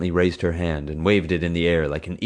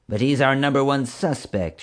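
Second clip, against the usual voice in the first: higher, plaintive whine; whining; 8 semitones higher than usual. Acted. Deflated officer whining to her boss. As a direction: plead like a thwarted child. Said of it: "she whined".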